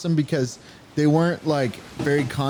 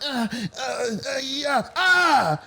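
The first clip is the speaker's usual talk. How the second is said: mock groaning; 10 semitones higher, much slower than usual. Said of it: "he's like".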